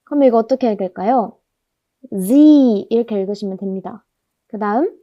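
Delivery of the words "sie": Z as a drawn-out "zee"; long vowel. Shown as a demonstration. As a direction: hold a long "ee" after the z in Z. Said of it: "이를 길게 발음한다고".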